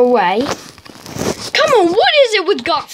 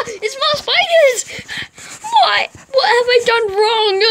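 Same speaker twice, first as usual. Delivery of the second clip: high, slow whimpering wail; fake-sob it, pitched up; mock crying; 9 semitones higher, slower than usual. Upset at spiders, crying sound.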